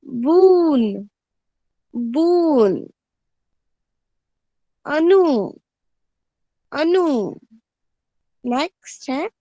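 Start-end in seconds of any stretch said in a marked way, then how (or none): 0.1-7.3 बून said loudly and slowly, then अनु; slow, loud model of बून and अनु; clear model reading. Shown as a demonstration. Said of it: "ब को छोटी ऊ की मात्रा". (बून और अनु साफ़-साफ़ बोलना)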